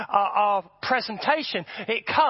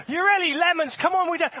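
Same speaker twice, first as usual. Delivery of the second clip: high, loud coaxing call; pushy, hammed-up leader egging people on; mock worship leader; 9 semitones higher, 5 dB louder than usual. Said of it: "And then he goes".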